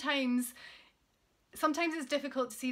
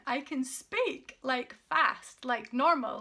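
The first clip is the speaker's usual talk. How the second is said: bright, louder, energetic delivery; upbeat and lively; 2 semitones higher, 5 dB louder, slower than usual. Real, not acted. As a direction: show off how well she talks now. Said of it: "as you can tell".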